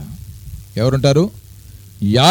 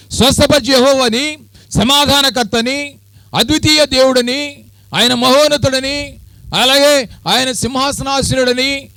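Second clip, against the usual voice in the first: preaching at full force; 11 semitones higher than usual. Acted. Preacher proclaiming God's names to the congregation, fervent. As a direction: pile up God's titles in rising fervour.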